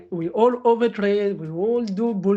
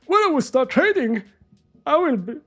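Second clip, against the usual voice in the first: higher, confident promise; eager brother; 4 semitones higher, 4 dB louder than usual. Retold or imitated. Imitating his brother boasting he'll follow rules. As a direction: promise it like a cocky beginner. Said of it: "He was like".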